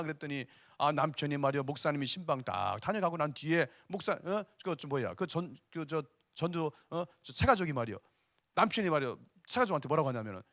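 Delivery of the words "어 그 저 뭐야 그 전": normal talking voice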